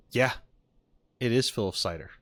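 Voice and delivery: usual speaking voice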